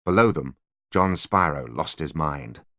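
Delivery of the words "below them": usual speaking voice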